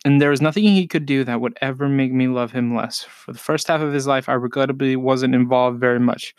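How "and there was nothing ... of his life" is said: usual speaking voice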